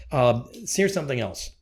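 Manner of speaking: normal talking voice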